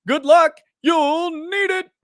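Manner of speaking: hammed-up arcade announcer calling out to the prosecution; Smash TV announcer. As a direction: shout it like an arcade announcer with a drawn-out last word. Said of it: "the announcer from Smash T V".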